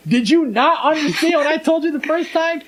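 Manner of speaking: high-pitched imitated indignation; hammed-up incredulous telling-off; mock scolding. Retold father scolding the girl.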